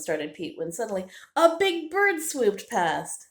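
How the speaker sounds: ordinary delivery, nothing marked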